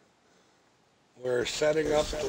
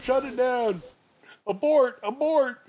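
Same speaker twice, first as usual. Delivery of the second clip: higher, louder mock alarm; mock panic call; 9 semitones higher, 4 dB louder than usual. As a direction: call it off like a mission abort. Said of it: "We're like".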